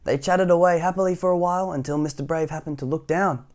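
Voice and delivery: usual speaking voice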